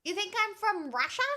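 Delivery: can't believe chat said that; surprised. Real, not acted. Chat says she looks Russian.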